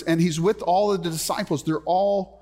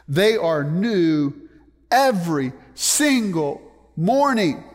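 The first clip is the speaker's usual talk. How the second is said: slow, loud, each word punched; emphatic preaching; 4 dB louder, slower than usual. Real, not acted. Preacher proclaiming new mercies to the congregation, emphatic. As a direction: drive home EVERY SINGLE MORNING word by word, slowly. Preacher drives home scripture promise.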